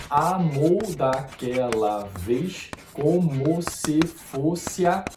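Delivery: very slow, counting out each poetic syllable; slow count of the verse's twelve syllables. Shown as a demonstration. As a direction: tick off all twelve syllables slowly. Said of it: "a contagem fica assim".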